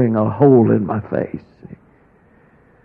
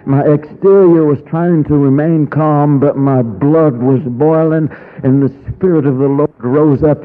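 ordinary delivery

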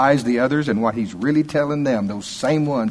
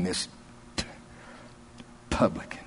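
disdainful, halting, spitting out the word publican; low, hushed contempt, with pauses before the last word; 5 semitones lower, 13 dB quieter than usual. Acted. A Pharisee praying about the publican, with hushed disdain. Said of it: "you can almost hear the disdain".

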